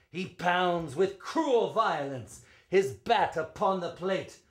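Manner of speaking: rising and falling ballad read, full of drama; spirited verse reading; dramatic poem recitation. Acted. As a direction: recite it like a storyteller at the ballpark.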